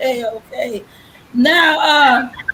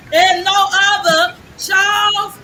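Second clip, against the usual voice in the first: raised, drawn-out name announcement; hype announcer; 5 semitones higher than usual. Real, not acted. Host brings out bass player to applause, hype announcer. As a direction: call the guest out high and slow like an MC. Hyping the final guest introduction.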